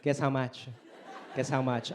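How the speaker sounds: lightly voiced proud mother; imitating mom. Son imitating mom showing off new shoes. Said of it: "She was like".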